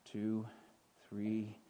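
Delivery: low, soft count with pauses; counting shepherd. Acted. Shepherd counting sheep at the gate, low and measured. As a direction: count off each sheep deliberately, hushed. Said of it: "and he would count".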